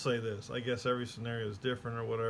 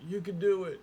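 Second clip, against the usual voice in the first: mock cheering; 7 semitones higher, 5 dB louder than usual. Others cheering the kid on.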